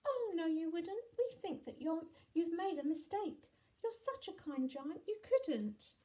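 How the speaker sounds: put-on high, loud child voice; high-pitched coaxing children; protesting children. A narrator's reading. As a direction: object like an earnest little child.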